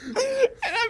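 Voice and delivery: very high, loud laughing fit; helpless laughter. Real, not acted. Telling his embarrassing audition, in helpless laughter. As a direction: crack up almost to tears.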